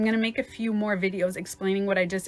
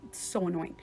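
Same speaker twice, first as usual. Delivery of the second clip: whispered, irritated grumble; 10 dB quieter, faster than usual. Acted. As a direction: grumble under your breath, almost voiceless. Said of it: "if you are low".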